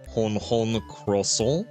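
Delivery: slow, hammy French voice; mock French accent. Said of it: "what did one French man say".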